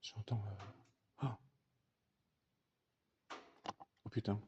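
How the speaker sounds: frightened hushed murmur; barely voiced, scared stiff; murmure tremblant. Real, not acted. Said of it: "j'ai tellement peur". (murmure apeuré)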